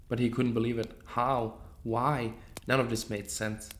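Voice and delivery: ordinary delivery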